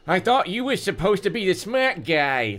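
high-pitched thug's sneer; hammed-up taunting snarl; mocking snarl. A narrator's reading. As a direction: snarl it as a dumb heavy. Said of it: "the chubby one snarled".

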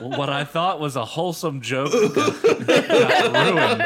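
loud, laughing, slow. Real, not acted. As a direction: complain while laughing. Laughing complaint.

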